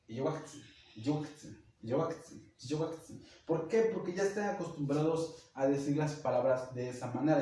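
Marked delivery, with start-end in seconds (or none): 0.1-3.2 Yiyuwaktzin with a rising end, softer, in another community's accent; sing-song regional accent on Yiyuwaktzin. Shown as a demonstration. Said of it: "tiene un acento".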